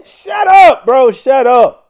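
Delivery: sudden loud, high shout of annoyance; fed-up outburst at the video. Real, not acted. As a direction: yell at the screen to shut up. Snapping at begging driver in clip.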